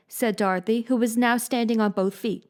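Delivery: usual speaking voice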